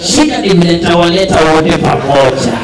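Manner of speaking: usual speaking voice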